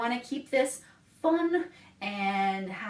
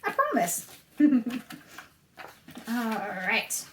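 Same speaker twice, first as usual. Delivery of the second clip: normal talking voice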